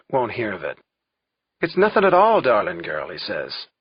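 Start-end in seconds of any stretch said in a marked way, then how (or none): 1.6-3.1 put-on lilting old man brushing off worry, high then dropping; dismissive old Mac. A narrator's reading. Mac reassures Kate about the police cars, breezily dismissive. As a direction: wave it off with a higher, louder opening.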